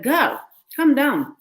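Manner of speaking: sassy retort. Retold or imitated. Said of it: "I'm like".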